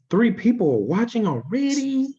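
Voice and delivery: gasp, then high, loud disbelief; surprised gasp. Real, not acted. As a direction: caught off guard, delighted. Surprise at viewer count.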